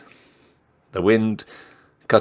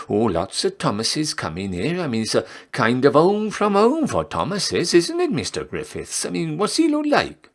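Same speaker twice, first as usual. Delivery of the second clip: chatty barmaid; 9 semitones higher than usual. Acted.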